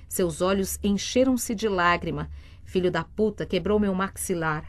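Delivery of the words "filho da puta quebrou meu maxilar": usual speaking voice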